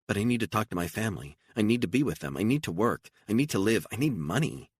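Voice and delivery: plead, stressing need each time; pleading Beatrice. Acted.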